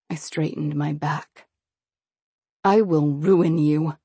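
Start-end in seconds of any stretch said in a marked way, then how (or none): 2.6-4.0 vow. A narrator's reading. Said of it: "I vowed".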